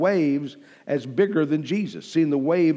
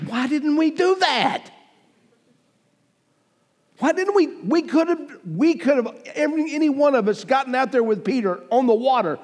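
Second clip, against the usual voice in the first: high-pitched mock outcry; envious disbelief; 7 semitones higher than usual. Preacher voicing the disciples left in the boat, high mock dismay. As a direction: voice their dismay way up high, hammed-up. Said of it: "know what they're all thinking".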